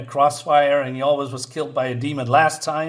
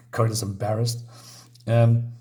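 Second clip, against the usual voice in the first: usual speaking voice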